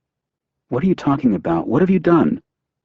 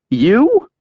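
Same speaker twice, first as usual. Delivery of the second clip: a sharp, much higher incredulous "You?"; surprised disbelief; 4 dB louder than usual. A narrator's reading. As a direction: let the shock jump out of her. She learns he has a gun.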